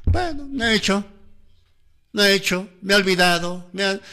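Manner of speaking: teacher imitating a student's excuse; mock student excuse. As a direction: play a kid whining that he forgot his homework.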